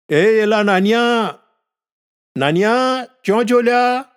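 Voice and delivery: put-on high, drawn-out holler for the boy; calling out. A narrator's reading. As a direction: call out for a child who is out of sight. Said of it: "તેણે નાનિયાને બૂમ મારી". (મોટેથી લંબાવીને બૂમ પાડવી)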